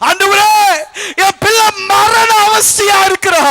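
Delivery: high, urgent pleading cry; loud begging. Acted. Father begging Jesus for his dying child, pleading loudly. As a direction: beg at full voice. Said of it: "அவரை போய் கெஞ்சி கேட்டாராம்".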